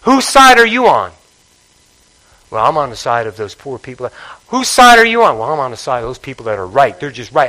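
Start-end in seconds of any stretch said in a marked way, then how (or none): 2.5-4.1 low, quiet, sheepish churchgoer answering; mumbled excuse. Pretend. Preacher voicing a churchgoer dodging the question, low and quiet. 5.4-7.5 low, quiet, sheepish churchgoer answering; mumbled excuse. Pretend. Preacher voicing a churchgoer dodging the question, low and quiet.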